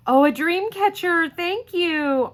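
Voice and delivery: high, loud delighted exclamation; delighted surprise. Real, not acted. Delighted discovery while opening gift.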